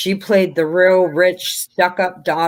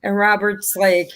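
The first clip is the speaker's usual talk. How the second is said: ordinary talk, nothing marked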